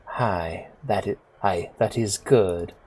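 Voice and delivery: put-on soft southern accent with Japanese mannerisms; quiet, courteous approval in light southern accent; Missus Kubota. Kubota approves the Ripper's fate, soft southern accent. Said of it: "giving her a southern accent".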